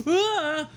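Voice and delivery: high, loud startled yelp; pained yelp. Retold or imitated. As the nurse pushes the swab up, sharp yelp.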